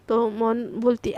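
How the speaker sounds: ordinary delivery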